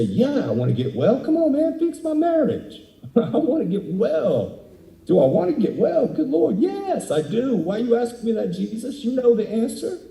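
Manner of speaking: high, whining complaint to Jesus; whiny petitioner. Retold or imitated. A churchgoer whining at Jesus to fix his marriage.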